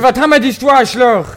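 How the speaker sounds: loud, high, angry yell; angry shout. Real, not acted. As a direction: bark it at your friend. Yells at Justin, then apologizes.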